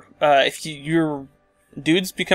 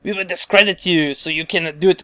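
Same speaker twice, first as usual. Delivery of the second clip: put-on terrible French accent; 2 semitones higher, 4 dB louder than usual. France taunting Prussia, in a bad mock French accent. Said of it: "I know that's terrible French accent".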